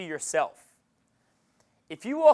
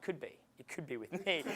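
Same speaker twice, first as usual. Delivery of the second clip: much softer, teasing; soft tease; 2 semitones lower, 14 dB quieter than usual. Retold or imitated. Coach to girl in a retold pickup, soft playful tease. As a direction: murmur it like a cheeky secret.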